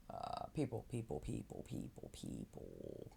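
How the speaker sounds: fading mutter. Real, not acted. Trailing repetition.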